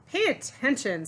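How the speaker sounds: mildly stern music-teacher snap; snapping maestro. Acted. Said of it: "snaps Maestro".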